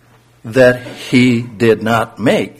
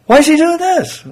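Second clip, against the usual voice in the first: high, puzzled worker asking aloud; 6 dB louder than usual. Acted. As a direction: play a baffled labourer wondering aloud. Puzzled worker voiced in parable.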